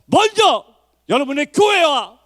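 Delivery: high, fervent exhortation, ringing out; preaching at full force. Real, not acted. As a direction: urge the congregation upward to prayer. (높고 뜨겁게 권면하는 설교)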